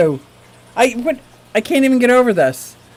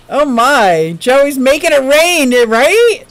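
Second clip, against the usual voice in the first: normal talking voice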